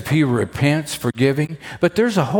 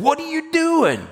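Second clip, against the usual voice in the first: sharp high rebuke; high indignant rebuke; rebuking. Shown as a demonstration. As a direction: snap it as a rebuke. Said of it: "That almost sounds like the other kind of rebuke".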